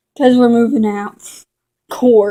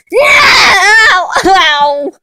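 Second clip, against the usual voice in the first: high loud yelps of pain; ow, ow, hurt and loud; 9 semitones higher, 6 dB louder than usual. Acted. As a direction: cry out as if struck. Cat hurt, crying out.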